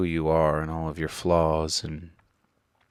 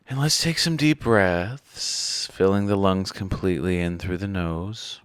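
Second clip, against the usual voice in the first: guided meditation; 2 semitones higher than usual. Acted. Leads breathing in guided meditation.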